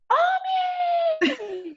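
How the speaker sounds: high, drawn-out call for someone; imitated shrill holler, stretched long. Mother calling out in retold story.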